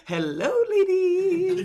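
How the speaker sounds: high, slow, laughing eunuch greeting. Retold or imitated. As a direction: put on a sing-song servant voice. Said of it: "He's like".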